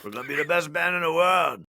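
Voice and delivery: high, bright Aussie rock star; Australian accent. Retold or imitated. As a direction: ham up an Australian frontman. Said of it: "He was like".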